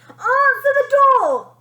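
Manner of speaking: high, loud, slow bellow. A narrator's reading. Bratty girl bellowing at her family, high and loud. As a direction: bellow it from the bed. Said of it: "Bonnie bellowed".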